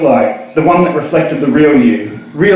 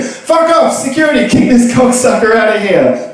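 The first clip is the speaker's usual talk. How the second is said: higher mock order to security; barman yelling; 8 semitones higher than usual. Barman to security, mock bark. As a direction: call the bouncers.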